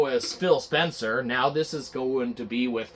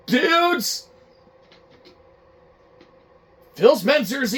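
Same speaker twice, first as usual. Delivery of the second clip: agitated shout; 7 semitones higher, 4 dB louder than usual. Acted. Employee relays boss's order, shouting.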